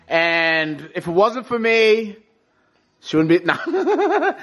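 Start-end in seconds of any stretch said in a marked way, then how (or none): 3.0-4.3 joke, then laugh. Real, not acted. Teasing his Pilates instructor, slow laughing. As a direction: trail off into a laugh.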